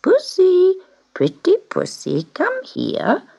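high, sing-song calling out for a cat; high-pitched old man's call. Acted. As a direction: coax the cat from the doorway in a high voice. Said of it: "a voice called".

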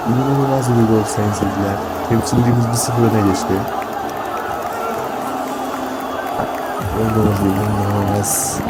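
loud, thrilled goal celebration; excited commentator. Acted. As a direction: shout the good news to viewers.

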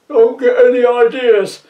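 loud, fast panicky plea; worried, quick. Acted. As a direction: blurt it out in a worried rush. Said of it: "said a worried Electrode quickly".

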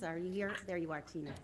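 ordinary talk, nothing marked

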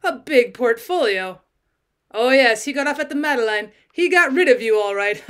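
loud, jeering amusement ending in a laugh; mocking laughter. A narrator's reading. Conductor mocking an abandoned wife, laughing. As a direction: laugh at her as you say it. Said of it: "The man began to laugh".

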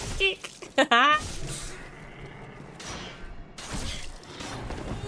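high-pitched squealing laugh; nervous high laughter; high giggle. Real, not acted. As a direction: burst into a high giggle that trails away. Startled in game, bursts out laughing.

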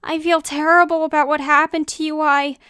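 regretful, high-pitched and insistent; distressed apology. Acted. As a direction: plead urgently for forgiveness. Frantic apology after hurtful words.

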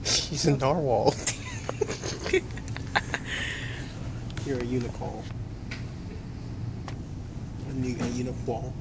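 soft, breathy library teasing with a stifled giggle; hushed teasing. Real, not acted. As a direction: tease her under your breath.

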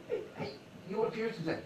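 quiet, furtive aside; hushed insider voice. Retold or imitated. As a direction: lean in and murmur it. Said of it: "a couple of guys going".

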